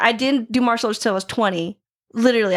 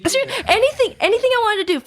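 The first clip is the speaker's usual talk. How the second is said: normal talking voice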